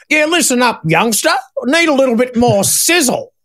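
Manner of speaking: [name]. Retold or imitated. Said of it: "and Angry's going".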